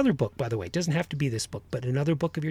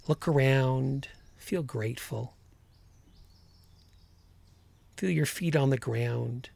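calm grounding exercise. Acted. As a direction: guide them gently into the body.

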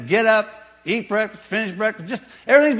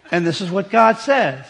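ordinary delivery

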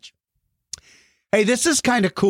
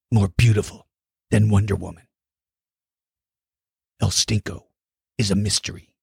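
dramatic movie-trailer voice; 9 semitones lower than usual. Pretend. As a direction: intone it like a mysterious legend. Dramatic mystery-hero announcer voice.

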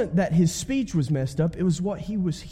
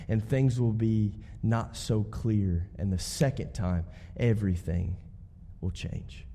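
much lower, fading to a whisper; hushed; 6 semitones lower, 6 dB quieter than usual. Real, not acted. Preacher pulling the congregation close, fading hush. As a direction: draw them in with a hush.

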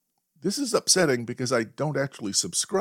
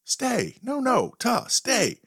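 high, louder and louder commands; yelling stay; 13 semitones higher, 5 dB louder, slower than usual. Retold or imitated. Owner yelling at the advancing dog, building high yell. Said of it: "And I yell".

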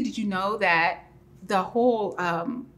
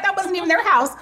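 higher and louder, mock outrage; indignant exclamation; 11 semitones higher, 6 dB louder, faster than usual. Friend protests the roof wasn't theirs, loud and high. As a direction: exclaim it, scandalised and amused. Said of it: "I'm like".

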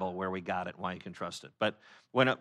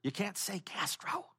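hushing local; 8 semitones higher than usual. Retold or imitated. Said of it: "they're like".

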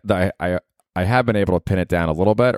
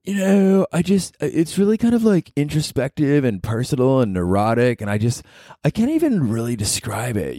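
high, airy mock of a self-important comic; pretentious comedian; 7 semitones higher than usual. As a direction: sneer at the comic's lofty talk. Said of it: "And he was saying, like".

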